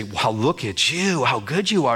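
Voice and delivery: voiced, insinuating enemy at the ear; whispering tempter. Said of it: "gonna whisper in your ear and say".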